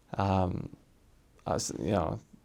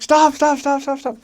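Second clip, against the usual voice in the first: high-pitched frantic repeated command; urgent; 6 dB louder than usual. Retold or imitated. Mission president warning the driver, high frantic shouts. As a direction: imitate someone yelling to halt, high and rapid. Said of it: "my mission president's like".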